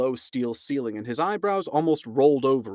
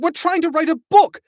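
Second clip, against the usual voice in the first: much higher, loud desperate cry; high frantic protest; frantic yell; 10 dB louder than usual. A narrator's reading. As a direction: shriek it out in panic. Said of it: "yelled Ford".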